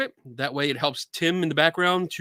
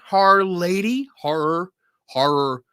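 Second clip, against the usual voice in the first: Philly accent; 2 semitones higher, 5 dB louder, slower than usual. Real, not acted. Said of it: "My Philly accent is having trouble".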